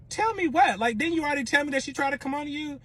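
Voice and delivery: high, fast demand; pressing him, exasperated; confronting boyfriend, high and fast. Retold or imitated.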